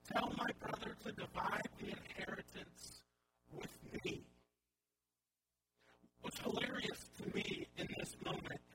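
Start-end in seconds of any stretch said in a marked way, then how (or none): none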